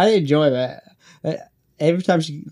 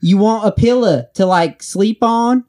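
put-on high, loud Southern-accented question; mock Southern accent, pitched up; imitated Southern accent; 8 semitones higher, 4 dB louder than usual. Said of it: "a Southern accent just does not make sense".

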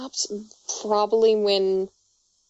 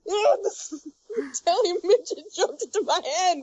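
high, startled cartoon yelp; panicked passerby; 8 semitones higher than usual. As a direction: play a bystander freaking out. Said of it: "He's like".

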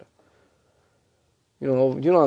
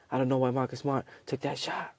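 much softer acted gossip; hushed overheard talk; 13 dB quieter than usual. Teammates gossiping about Smart in the hallway, hushed. Voices overheard behind an opened door.

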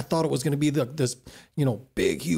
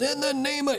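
preacher voice; 11 semitones higher than usual. Retold or imitated. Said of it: "he comes to me, and goes".

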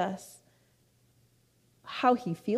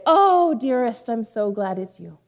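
delighted mentor; 2 semitones higher, 5 dB louder than usual. Retold or imitated. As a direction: greet a beloved caller, imitating the mentor. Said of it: "her mentor would say".

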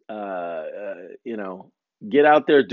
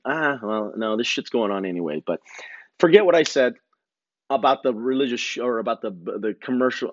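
ordinary talk, nothing marked

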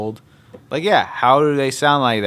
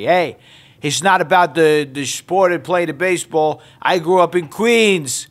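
heated New York argument, building higher and louder; New York accent; 9 semitones higher, 4 dB louder than usual. Retold or imitated. Childhood friends arguing over Queens, heated New York accent. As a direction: bicker in an exaggerated, high-pitched New York accent. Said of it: "New York accents".

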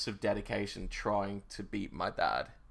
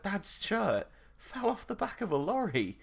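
put-on innocent child voice; 12 semitones higher than usual. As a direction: say it like a proud innocent kid. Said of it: "I was like".

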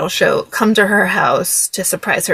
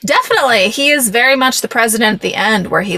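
usual speaking voice

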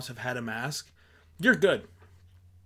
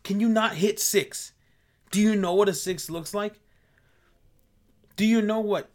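higher, louder mocking rant; exasperated ranting; 5 semitones higher, 4 dB louder than usual. Real, not acted. Ranting at people who ignore six feet, higher exasperation. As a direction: scold them like they can't count.

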